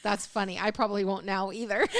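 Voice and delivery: normal talking voice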